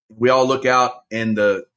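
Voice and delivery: ordinary talk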